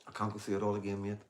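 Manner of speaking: usual speaking voice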